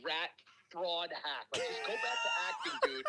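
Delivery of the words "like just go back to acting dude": high, laughing jab; laughing rant. Real, not acted. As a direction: crack up while mocking him. Ranting about rival.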